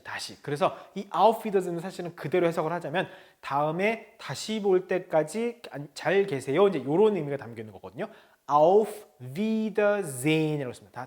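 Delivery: ordinary delivery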